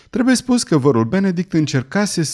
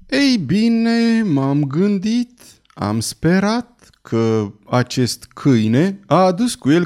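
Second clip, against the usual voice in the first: higher, slow, earnest entomologist voice; fussy scholar; 7 semitones higher, slower than usual. Acted. Cousin Benedict to Mrs Weldon, hopeful, slow and higher. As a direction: drag out his hopeful theory, wide-eyed.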